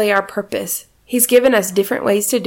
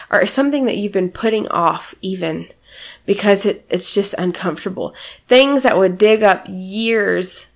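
ordinary talk

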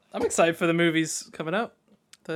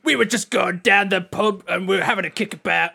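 Charlie Hunnam; 4 dB louder than usual. Retold or imitated. Friend mocking the actor and his mates in King Arthur, geezer voice. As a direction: do a mock London geezer, loud. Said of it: "It's like Charlie Hunnam and his mates".

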